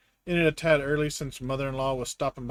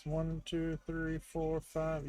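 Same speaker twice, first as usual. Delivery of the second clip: muttered count under breath; counting quietly; 3 semitones higher, 10 dB quieter, slower than usual. Real, not acted. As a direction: count softly to yourself.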